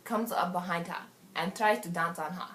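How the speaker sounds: lightly put-on African accent. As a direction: tell the party story in an African accent. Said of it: "I'm gonna go to African".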